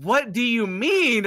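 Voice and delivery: drawn-out, high, loud incredulous cry; hammed-up disbelief. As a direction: stretch out the question in mock outrage. Repeats startled reply to mom, bigger.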